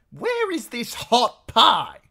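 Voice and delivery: high, loud, slow demand; innkeeper's wife voice. A narrator's reading. Innkeeper's wife inspecting newcomers, high loud demand.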